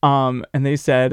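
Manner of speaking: ordinary talk, nothing marked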